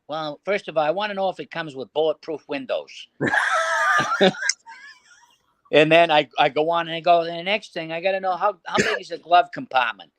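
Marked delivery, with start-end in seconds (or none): 0.1-3.1 lightly voiced Boston mobster on a prank call; a hint of a TV wiseguy, casually making demands; Louis from East Boston. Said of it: "Louis from East Boston voice was similar to". 7.2-10.1 Boston mobster, pitched up; higher put-on wiseguy voice for a prank call; casually menacing mock-gangster customer; Louis from East Boston. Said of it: "Louis from East Boston voice was similar to".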